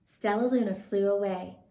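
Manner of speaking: ordinary delivery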